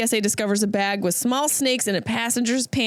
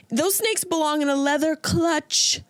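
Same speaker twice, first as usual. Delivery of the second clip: ordinary talk, nothing marked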